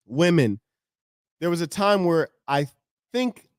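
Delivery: usual speaking voice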